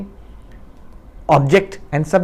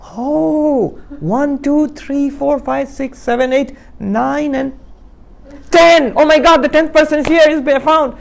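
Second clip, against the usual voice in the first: excited discovery; 10 semitones higher than usual. Acted. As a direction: count up to the joyful surprise. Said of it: "And this guy goes".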